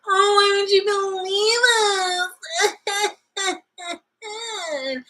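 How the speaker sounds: high, drawn-out whiny wail; mock wailing. Commenter mimicking upset women who want belief, mock wail. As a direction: wail it as a mocking imitation.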